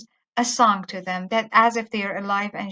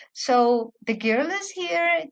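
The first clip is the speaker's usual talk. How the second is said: ordinary delivery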